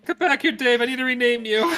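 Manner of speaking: high teasing call to a player; playful high call. Acted.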